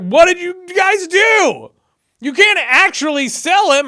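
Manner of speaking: high, loud, slow shouted protest; outraged shout. Retold or imitated. Older brother yelling at his brothers, outraged shout. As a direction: yell at them in disbelief. Brother finds Joseph sold.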